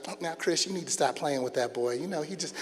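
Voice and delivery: soft, low, easygoing scolding; nice mom. Retold or imitated. Mom passing the wrestling match, mildly chiding. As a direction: lightly voice an unbothered mother, softly. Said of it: "she's all nice".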